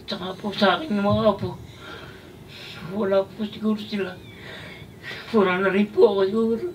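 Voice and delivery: tearful, voice high and breaking; choked-up thanks through tears; crying. Real, not acted. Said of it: "Naiiyak kayo". (umiiyak habang nagpapasalamat)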